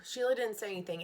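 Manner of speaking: plain reading voice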